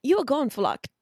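put-on English accent, pitched high; bad English accent. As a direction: attempt a clumsy British accent. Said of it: "I gotta do this in English accent".

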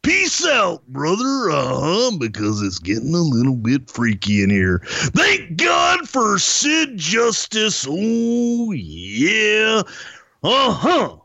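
slow wrestler promo with high, strained surges; Macho Man. Acted. Wrestler rambling before a match, exaggerated promo impersonation. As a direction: draw it out, swooping high on key words. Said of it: "Macho Man Randy Savage just keeps saying".

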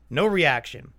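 ordinary talk, nothing marked

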